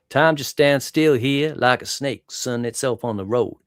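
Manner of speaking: put-on a Louisiana accent, slow and sleepy; Southern drawl quote. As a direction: drag it out like a lazy Southern character. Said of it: "the Kevin Bacon character".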